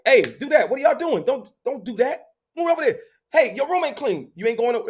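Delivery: mock strict father yelling at kids; harsh barking orders. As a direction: bark chores at the kids like a strict parent. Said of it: "I come from a very harsh".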